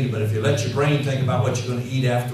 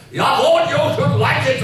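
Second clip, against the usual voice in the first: put-on much higher, louder German-accented mentor; 13 semitones higher, 5 dB louder than usual. German mentor telling the young convert to like it, in a German accent. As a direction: play the big German mentor in a thick German accent. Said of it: "A big German guy".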